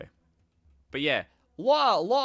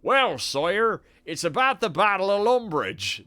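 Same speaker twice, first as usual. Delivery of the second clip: loud, higher Somerset accent for the duke; 5 semitones higher, 7 dB louder than usual. Shown as a demonstration. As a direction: give the duke a broad West Country Somerset voice. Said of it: "I was thinking of giving him a Somerset accent".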